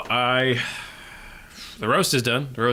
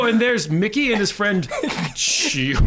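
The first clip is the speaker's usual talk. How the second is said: pretend clueless fan; 8 semitones higher, 4 dB louder, much slower than usual. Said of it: "pretending to be like".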